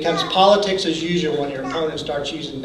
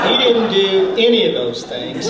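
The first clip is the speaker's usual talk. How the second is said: laughing; 3 semitones higher, 4 dB louder than usual. Real, not acted. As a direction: still chuckling through the words. Still laughing after punchline.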